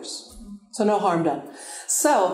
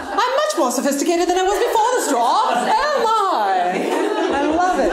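mock British accent; 9 semitones higher, 4 dB louder than usual. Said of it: "Also had a British accent".

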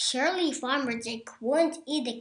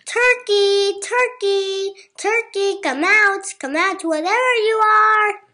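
loud, higher call; 7 semitones higher, 9 dB louder than usual. A narrator's reading. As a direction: call out across the barn. Farmer calling through the barn.

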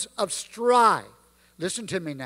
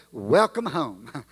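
tender welcome; 7 semitones lower, slower than usual. Acted. Savior's voice in hymn.

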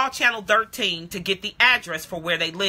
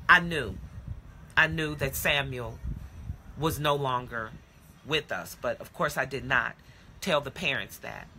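hushed grief; 8 semitones lower, 10 dB quieter than usual. Acted. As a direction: let the words sink in heavy silence. Realising the boy is dead.

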